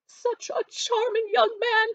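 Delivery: high, slow, gushing matron with a cry in the voice; gushing Mrs Bennet. A narrator's reading. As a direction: coo it like a thrilled social-climbing mother.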